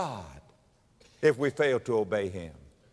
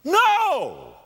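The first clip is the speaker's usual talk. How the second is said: high, loud, sharp denial; emphatic no; 13 semitones higher, 6 dB louder than usual. Real, not acted. Preacher rejecting the hymn's critics, loud and sharp. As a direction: cut the objection off hard.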